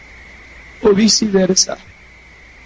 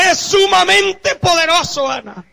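proclaiming shout; 7 semitones higher, slower than usual. Retold or imitated. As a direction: proclaim God's glory aloud. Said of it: "el Padre dice". (proclamación gritada y lenta)

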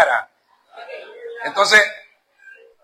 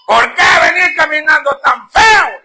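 put-on high, loud drunken shout at his son, slowed; drunk father shouting; 11 semitones higher, 9 dB louder than usual. Drunk father yelling at his son down the road, high slow shout. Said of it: "le dijo así fuerte".

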